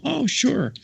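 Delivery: way up high and bright; high delighted voice. Retold or imitated. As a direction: chirp it happily. Nun answering, voiced.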